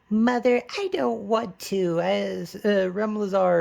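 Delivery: high whiny daughter voice; whiny teen girl. Retold or imitated. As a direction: sulk at mother in a high voice. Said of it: "You're like".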